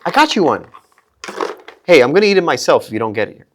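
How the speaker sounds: casual American coaxing; American accent. Acted. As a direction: bargain like an American buddy. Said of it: "the regular American".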